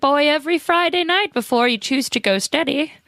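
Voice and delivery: lightly voiced grandma giving advice; a hint of a sweet old grandma. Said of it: "That's my grandma voice".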